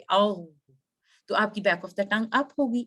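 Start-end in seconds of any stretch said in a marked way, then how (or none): 0.0-0.4 a throaty gargling "औ"; gargle sound. Shown as a demonstration. Teacher demonstrating a gargle, throaty. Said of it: "इस तरह से आवाज निकाल के".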